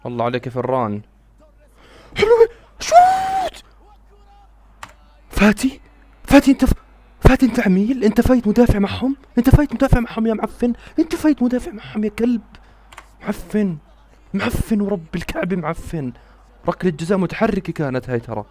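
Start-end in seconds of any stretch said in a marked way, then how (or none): 2.2-3.6 very high, loud urging yell; excited scream. Real, not acted. Fan demanding his player shoot, excited screaming. 5.3-12.4 loud, high, outraged tirade; furious ranting. Real, not acted. Fan berating Fati for a miss, furious shouting. 13.2-16.1 angry cursing. Real, not acted. Still cursing Fati.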